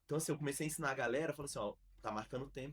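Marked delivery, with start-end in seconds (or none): none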